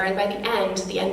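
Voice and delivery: ordinary talk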